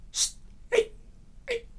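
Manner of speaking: hissed whisper to get attention; hushed urgent call. Acted. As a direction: hiss for his attention under your breath.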